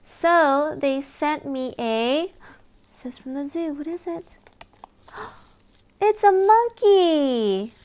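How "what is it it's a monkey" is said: usual speaking voice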